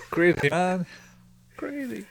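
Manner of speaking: high, helpless laughter round the words; laughing. Real, not acted. Cracking up over the crazy character, laughing. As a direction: crack up, then squeak it out.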